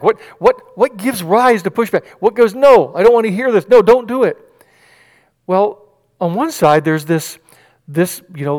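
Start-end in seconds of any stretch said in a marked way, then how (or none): 0.0-4.4 raised, insistent refusal; mock objection. Preacher voicing the inner objection, raised and insistent. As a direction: play the voice that pushes back.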